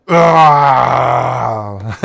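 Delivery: loud zombie groan; zombie roar. Pretend. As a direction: bellow it out like a zombie. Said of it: "Can you give me your best zombie?".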